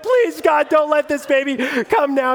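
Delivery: high, panicked prayer, hammed up; terrified plea. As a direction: reenact a frantic plea to God. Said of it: "I was absolutely terrified".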